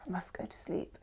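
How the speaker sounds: very soft, whispered thought to oneself; mock-anxious whisper; hushed inner urging. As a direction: whisper it like a private worry. Acted thoughts of an anxious insomniac.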